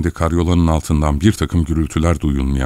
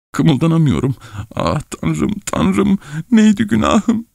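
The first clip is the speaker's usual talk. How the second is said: high-pitched frantic pleading; acted comic desperation, voice climbing high; panicking hidden man; 9 semitones higher than usual. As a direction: squeal it in a high voice, about to sneeze. Man under bed panics, about to sneeze.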